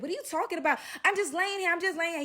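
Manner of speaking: high-pitched imitation of protesting; imitating an affronted girl; mock indignant denial. Girl's acted denial in quarrel.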